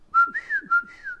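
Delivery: a high, sudden laugh; burst of laughter. Real, not acted. Vlogger laughing at the horse bucking, a burst of laughter. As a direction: laugh out loud at the comic.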